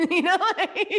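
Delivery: high bursts of real laughter breaking through the words; laughing. Real, not acted. Guest cracks up telling the host she ate the hate messages up. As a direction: laugh it out, delighted.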